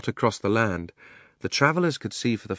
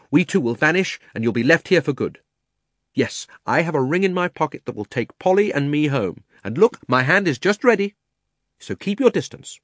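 put-on high, loud boy's threat; urgent raised voice, pitched well up; shouted warning; 7 semitones higher, 7 dB louder than usual. A narrator's reading. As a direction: shout a defiant warning to keep them back. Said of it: "shouted out".

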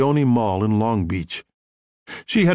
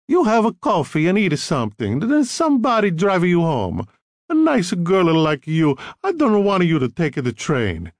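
bossy Italian mother, higher; heavy Italian accent; 6 semitones higher than usual. A narrator's reading. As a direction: fuss over her in an Italian accent. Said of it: "She had a heavy Italian accent".

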